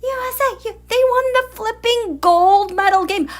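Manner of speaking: excited. Real, not acted. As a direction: cheer about the gold medal. Excited about hockey win.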